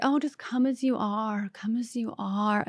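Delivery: lightly put-on sing-song voice fading to a whisper; a hint of mocking, sing-song then whispered; mocking the saying. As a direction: parody the people who say it, drifting off breathy. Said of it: "when people are like".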